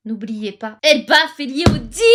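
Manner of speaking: normal talking voice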